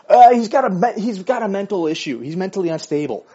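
mock yell. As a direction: shout it out like the frantic wife. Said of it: "don't yell out".

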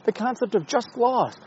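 high, loud mocking voice of an impressed fan; mock admiring onlooker. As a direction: gush over the thinker as a sarcastic fan. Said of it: "It's like".

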